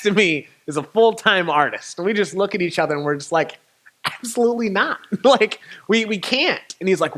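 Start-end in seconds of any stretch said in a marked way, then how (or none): 4.0-6.8 high, incredulous flat no; emphatic refusal. Retold or imitated. Two broke artists to the thousand-dollar quote, incredulous refusal. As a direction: reject it with a near-laughing no.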